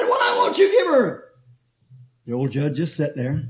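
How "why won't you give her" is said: mock pleading lawyer. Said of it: "He said".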